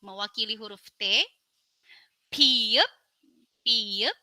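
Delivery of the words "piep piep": piep said clearly with a rise; letter name demo. Shown as a demonstration. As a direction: model piep twice.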